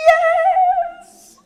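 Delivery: very high, loud delighted yes; real thrilled yell; excited cheer. Real, not acted. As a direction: celebrate the shout-out. Cheering for Pakistani audience members.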